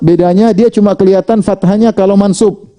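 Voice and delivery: normal talking voice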